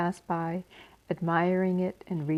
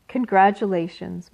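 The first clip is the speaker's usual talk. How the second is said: plain reading voice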